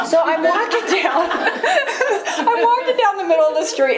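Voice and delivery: words broken by high laughter, slowing down; can't stop giggling as she tells it; laughing through words. Real, not acted. Laughing while retelling the walk.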